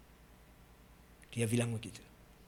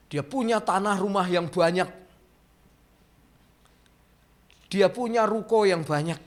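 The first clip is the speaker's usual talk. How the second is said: louder, rising preacher's cry; forceful preaching; 6 semitones higher, 6 dB louder than usual. Acted. Preacher tells the congregation of a sinner's wealth, loud and driving.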